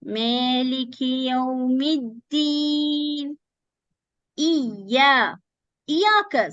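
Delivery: Maliki Yawmiddin drawn out with the dal clear; slow recitation. Shown as a demonstration. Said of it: "The mim you have to pronounce with the dal".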